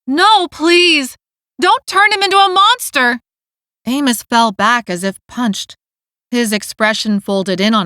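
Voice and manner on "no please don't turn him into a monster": high, desperate cry of no; pleading scream. A narrator's reading. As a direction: scream it, begging them to stop. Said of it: "Dessa screamed".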